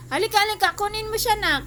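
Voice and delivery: high, loud summons; urgent calling. Real, not acted. Owner calling nak to take the dog, loud urgent call. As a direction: call out loudly for help with the dog.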